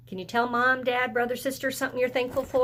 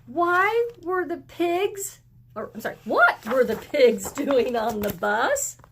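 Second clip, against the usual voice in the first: high, slow, amazed question with a laugh; puzzled mother; 5 semitones higher, 4 dB louder than usual. Acted. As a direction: ask in amused disbelief. The mother asks about the pigs.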